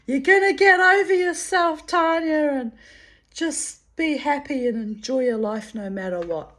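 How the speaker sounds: high, loud bossy call from above; mock yell. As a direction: call down cheerfully scolding. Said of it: "he's yelling down from the spirit world".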